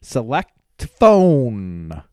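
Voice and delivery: loud, spaced-out command; yelling. Retold or imitated. Yelling at the car in the driveway, loud. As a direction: bellow the last word. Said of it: "just yell at it".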